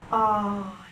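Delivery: usual speaking voice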